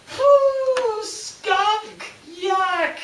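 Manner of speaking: very high, loud cry of disgust; disgusted kid. Retold or imitated. Simon runs from the cave holding his nose, high disgusted squeal. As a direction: squeal it as if the smell is awful.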